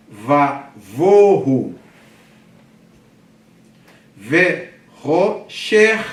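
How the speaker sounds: loud, separated Hebrew syllables, long pauses; didactic syllable reading. Shown as a demonstration. Teacher reading Hebrew verse to class, loudly syllable by syllable. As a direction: project each piece slowly for beginners.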